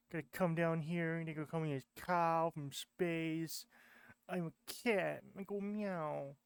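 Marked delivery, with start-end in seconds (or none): none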